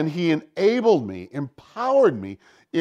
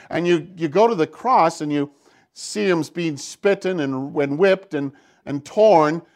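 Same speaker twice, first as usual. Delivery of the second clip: loud, rising pulpit fervor; fervent preaching; 3 semitones higher, 6 dB louder than usual. Real, not acted. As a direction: drive it home to the congregation. Builds into full preaching.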